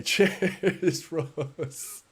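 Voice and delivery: laughing thanks. Real, not acted. Streamer thanks viewer for a fiver, laughing. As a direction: break into a laugh while thanking.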